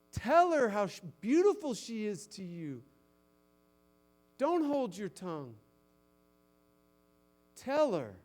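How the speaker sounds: loud, high, driving sermon voice; preaching at full force. Acted. Pastor urging husbands to praise their wives, preaching loud.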